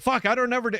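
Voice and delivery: high, sheepish exasperated protest; retold exasperation. Boss protesting to Bell about the ringer, high and exasperated. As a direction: pitch it way up, caught out. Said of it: "I was like".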